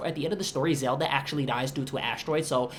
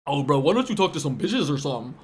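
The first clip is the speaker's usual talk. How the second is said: mocking bully; 3 semitones lower than usual. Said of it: "People used to fucking clown me".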